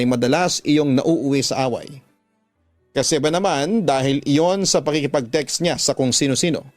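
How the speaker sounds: ordinary talk, nothing marked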